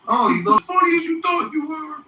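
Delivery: high mocking taunt. Mocking Pro after poll.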